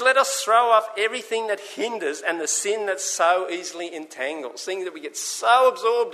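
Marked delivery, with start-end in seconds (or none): 0.0-4.6 raised, ringing scripture read; preacher reading the Bible aloud. Acted. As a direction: proclaim the verse to the congregation. Reads scripture again.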